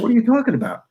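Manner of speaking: high-pitched incredulous question, retold; baffled disbelief. Comedian questioning the stranger's strange claim, incredulous. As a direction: act out total bafflement, voice jumping up.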